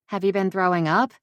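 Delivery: usual speaking voice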